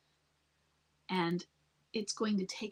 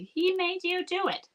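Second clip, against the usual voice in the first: higher, mocking whine of a victim; 11 semitones higher, 4 dB louder than usual. Advising a wife, mocking victim-style blaming in a higher whine. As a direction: parody the blaming victim line, pitched up. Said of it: "I don't like to take it in a victim way and say".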